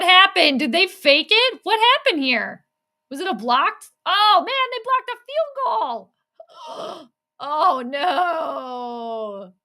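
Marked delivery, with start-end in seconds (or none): 4.1-9.5 anguished cry. Real, not acted. Reacts to the blocked field goal.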